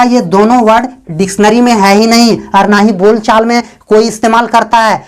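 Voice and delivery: ordinary talk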